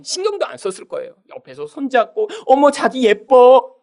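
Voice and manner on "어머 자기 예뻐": slow, higher-pitched mock sweet talk; hammed-up lovey-dovey cooing; cooing lover. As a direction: gush over a sweetheart, drawing it out. Mimics lovers cooing in public.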